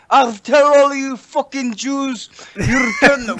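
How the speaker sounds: Sheiky impression. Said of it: "are you Sheiky?".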